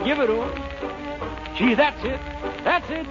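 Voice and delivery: ordinary talk, nothing marked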